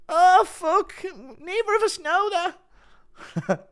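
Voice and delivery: very high, hammed-up fighter voices; mock movie scene. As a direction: squeal through a farcical mistaken-identity scene. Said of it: "like one of those movie scenes".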